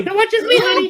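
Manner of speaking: very high, laughing flirt; mock flirty mom. Mom answering in the dark theater, very high mock coo. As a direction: coo it like a joke.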